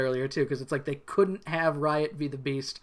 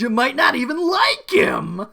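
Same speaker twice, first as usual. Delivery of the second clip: high, loud, slow mock warning; overplayed theatrical line; dramatic warning; 9 semitones higher, 9 dB louder than usual. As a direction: ham up the ominous hint. Acts out Mac's dramatic line.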